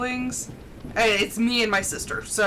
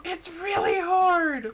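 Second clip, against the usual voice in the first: whiny cry; 8 semitones higher, much slower than usual. Retold or imitated. Child protesting to her mom, crying out. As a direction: wail it like a frustrated kid.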